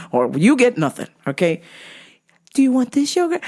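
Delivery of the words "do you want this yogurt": high-pitched mock-mom question, rising; coaxing mother imitation. Mother offering her child yogurt, high mock coaxing.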